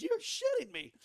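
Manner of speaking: high, laughing disbelief; amazed disbelief. Retold or imitated. Rider seeing his crashed bike still upright, high laughing disbelief. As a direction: squeal it in delighted shock. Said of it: "I'm like".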